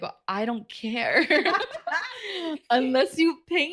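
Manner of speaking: cackling villainess, high and laughing; a laughing wicked goddess; villainess cackle. Retold or imitated. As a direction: cackle through it like a greedy villain. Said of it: "cackling like a villainess".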